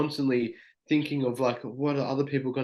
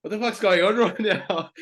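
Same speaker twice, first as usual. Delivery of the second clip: high-pitched, laughing mock bewilderment; amused retold outburst, pitched up; laughing disbelief; 7 semitones higher, 3 dB louder than usual. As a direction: quote it through a laugh. Retold funny moment, laughing while quoting.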